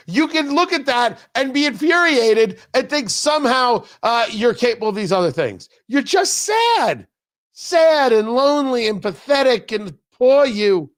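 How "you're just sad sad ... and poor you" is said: mock pity. Mocking the angry critics.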